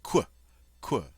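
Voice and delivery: qu said as a K gliding into W, kw; demonstrating the English kw sound. Shown as a demonstration. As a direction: model the English qu as kw, twice. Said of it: "it has a K W sound".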